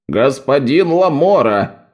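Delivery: usual speaking voice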